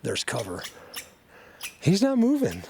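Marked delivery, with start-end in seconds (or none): 1.8-2.6 high sing-song call to a bird; pet voice. Real, not acted. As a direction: coax the little bird sweetly. Talking to bird.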